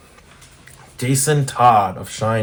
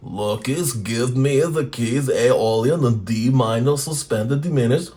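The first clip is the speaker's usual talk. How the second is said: a hint of a slow Russian machine voice; mildly Russian, slow character bark; lightly voiced Russian death clock; 2 semitones higher, slower than usual. Vlogger ad-libbing as the death clock, light Russian accent.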